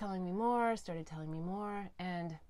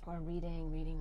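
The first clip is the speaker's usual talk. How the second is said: ordinary delivery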